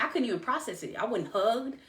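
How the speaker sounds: usual speaking voice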